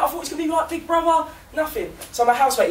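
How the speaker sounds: ordinary talk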